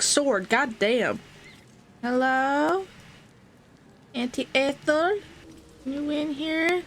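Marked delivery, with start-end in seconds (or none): 2.0-6.8 high, sing-song calling into a house. Real, not acted. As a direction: call out to someone unseen.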